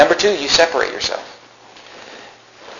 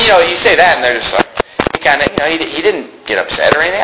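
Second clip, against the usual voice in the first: usual speaking voice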